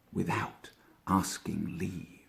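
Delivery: hushed, slow whisper. A narrator's reading. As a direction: breathe it out like a secret. Sneaky badger moving in uninvited.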